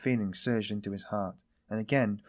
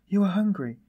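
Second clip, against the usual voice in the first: high, loud, urgent outburst; sudden cry; 9 semitones higher, 7 dB louder than usual. A narrator's reading. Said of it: "but suddenly she cried".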